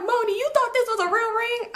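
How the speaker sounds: high teasing disbelief; mocking family. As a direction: rib her about the real ring. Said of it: "They like".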